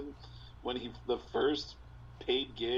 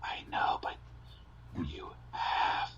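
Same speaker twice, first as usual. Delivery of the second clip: hushed whisper, slow and low; 7 semitones lower, slower than usual. Retold or imitated. Comic telling a heckler to shut up, in a slow whisper. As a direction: lean in and hiss it under your breath.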